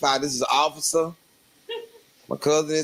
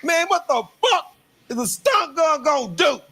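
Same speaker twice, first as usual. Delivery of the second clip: put-on drunk dare; 13 semitones higher than usual. Drunk cousin to the officer, high scoffing yell. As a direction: scoff at the stun gun. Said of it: "My cousin said".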